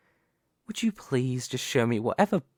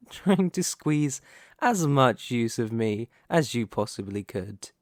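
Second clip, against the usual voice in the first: normal talking voice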